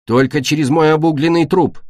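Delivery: ordinary delivery, nothing marked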